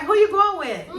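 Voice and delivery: high, loud mock questioning; nosy mother. Mother asks her son about his plans, mock nosy. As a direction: press him like a worried mom.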